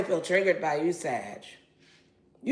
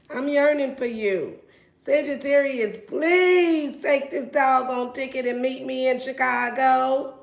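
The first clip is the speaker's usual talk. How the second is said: begging ex lover; 4 semitones higher than usual. Pretend. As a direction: beg the Sag to come back, then yell. Voicing the yearning ex begging.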